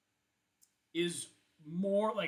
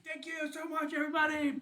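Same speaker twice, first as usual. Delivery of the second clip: band thanking crowd; 12 semitones higher, 5 dB louder than usual. Acted.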